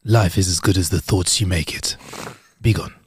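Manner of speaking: low, soft wise sage; mystic sage voice. Retold or imitated. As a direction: intone it like a guru, fading. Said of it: "say something like".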